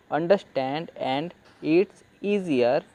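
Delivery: very slow, every word drawn out; slow reading aloud. Shown as a demonstration. Teacher reading the English phrase slowly to students. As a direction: read the words slowly as a model. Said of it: "तो easier को हम पढ़ लेते हैं".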